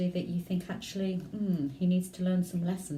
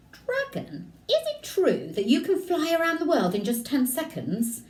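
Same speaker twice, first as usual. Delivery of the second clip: much higher, sweet coaxing question; flattering princess; 9 semitones higher, 4 dB louder than usual. Acted. Elizabeth tricking the dragon, high wheedling voice. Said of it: "Elizabeth said".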